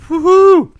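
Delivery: loud, high whoop; excited cheer. Real, not acted. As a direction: whoop to celebrate the champagne. Popping champagne, celebration cheer.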